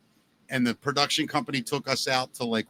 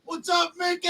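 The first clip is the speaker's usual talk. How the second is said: mock a guy hollering from a passing truck; imitated yell. Dude in a truck spots him at the gas station, imitated high yell. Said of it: "some dude yells out of truck".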